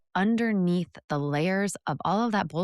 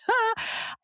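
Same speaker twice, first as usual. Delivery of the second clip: high single burst of laughter; quick laugh mid-sentence; short laugh. Real, not acted. Laughs at own hesitation.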